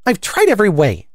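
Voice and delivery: high, mildly desperate complaint; desperate, sobbing. A narrator's reading. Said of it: "desperately with a kind of sob".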